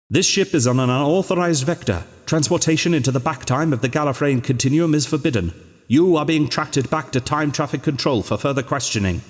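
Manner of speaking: official voice. A narrator's reading. A disembodied control voice addressing the ship, official and stern. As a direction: recite it like a cold recorded warning. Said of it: "a voice spoke out of the air".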